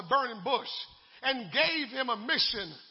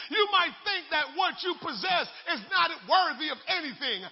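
ordinary talk, nothing marked

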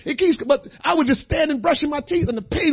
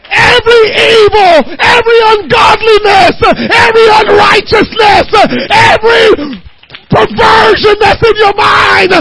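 higher, louder, slow, with a cry; crying preacher's shout; 10 semitones higher, 9 dB louder, slower than usual. Acted. Preacher commanding the church to destroy evil thoughts, crying out. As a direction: hammer each line with a tearful shout.

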